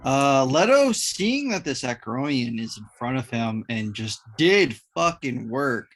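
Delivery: ordinary talk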